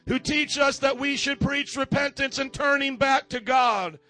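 rising fervent preaching, pushing each line; full-force preaching. Acted. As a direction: preach it hard to the congregation.

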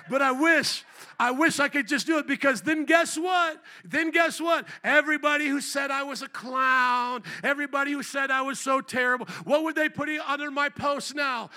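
high, loud preacher's build; preaching at full force. Real, not acted. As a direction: drive it home to the congregation, loud and high.

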